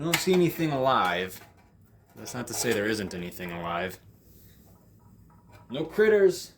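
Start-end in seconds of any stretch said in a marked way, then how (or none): none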